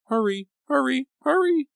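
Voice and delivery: high, loud frantic repeated plea; frantic urging. A narrator's reading. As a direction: keep urging, rising in panic. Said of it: "Grace kept urging".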